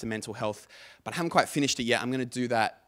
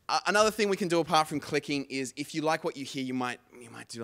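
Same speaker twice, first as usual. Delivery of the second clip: usual speaking voice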